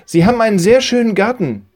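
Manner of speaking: loud, high and brisk; boy calling out. Acted. Joel leaving praises the lady's garden, high loud quick call. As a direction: shout a quick compliment on your way out.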